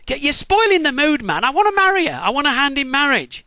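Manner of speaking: high, loud exasperated protest; indignant protest. Acted. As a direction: raise your voice, fed up and pleading. Jacob protests to Laban indignantly.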